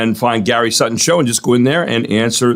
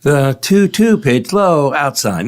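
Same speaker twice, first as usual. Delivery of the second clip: slow baseball announcer call; Vin Scully impression; much slower than usual. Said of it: "are you gonna do your Vin Scully?".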